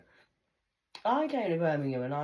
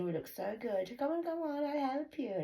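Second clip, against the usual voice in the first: lightly voiced high smug fake helpers; smug priests; 11 semitones higher than usual. Mocking the godly people in robes, a light high smug voice. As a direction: sweeten it up like smarmy clergy. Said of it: "they dress very nice in fancy robes".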